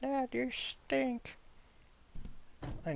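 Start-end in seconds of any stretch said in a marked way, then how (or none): 0.0-1.4 put-on high, slow whiny child voice; bratty kid voice. Son taunting his father in a high slow child voice. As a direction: play the son sneering at his dad.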